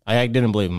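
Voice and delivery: ordinary delivery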